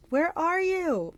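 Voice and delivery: high, slow drawn-out yell; searching monster's high cry; screaming. A narrator's reading. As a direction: scream it, stretching the words. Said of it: "Polyphemus screamed".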